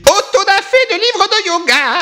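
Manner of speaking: high, drawn-out cry; hammed-up zealot's chant; mock fanatical proclamation. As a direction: proclaim it slowly at a high pitch. Mock proclamation of book burning.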